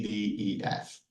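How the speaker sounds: usual speaking voice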